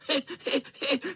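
high mischievous cat cackle; spiteful cackle. A narrator's reading. Spiteful tomcat cackling at the chasing boys. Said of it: "Chechtal se zlomyslný kocour".

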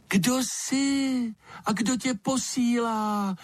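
very high, slow chick-like peep; thin, piercing little voice. A narrator's reading. Hidden creature questions the lizard, thin cutting little voice. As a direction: squeak it thinly like a baby bird, drawn out. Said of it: "hlásek tenký jako pípot ptačího mláděte".

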